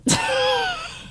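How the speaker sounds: a high, breathy squealing laugh; high squeal of laughter. Real, not acted. Laugh after retelling.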